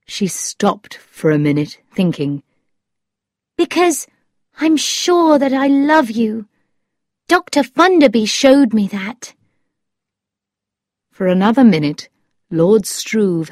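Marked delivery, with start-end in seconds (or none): 3.6-9.3 high but less forceful declaration; sincere storybook young woman; Thetis, tender. A narrator's reading. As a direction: confess love in a high girlish voice.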